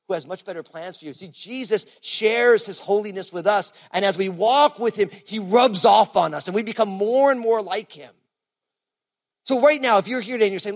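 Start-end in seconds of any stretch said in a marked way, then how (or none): 1.1-10.5 fervent preaching. Acted. Preaching builds.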